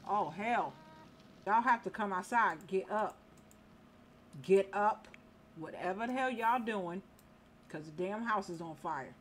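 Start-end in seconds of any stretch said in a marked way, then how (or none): 4.4-5.1 barked louder order; louder command. Real, not acted. Player ordering sleeping sims up during a house fire, louder. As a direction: raise the voice to rouse them.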